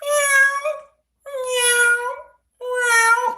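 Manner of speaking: high woos turning into crying meows; imitating a cat switching to meows. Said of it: "They go".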